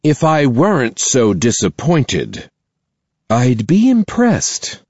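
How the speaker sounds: a headmistress weighing her words; stern principal voice. Acted. Principal scolds student.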